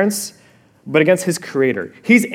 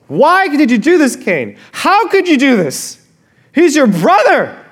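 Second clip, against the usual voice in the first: anguished parents; 7 semitones higher, 3 dB louder than usual. Retold or imitated. Parents confronting Cain.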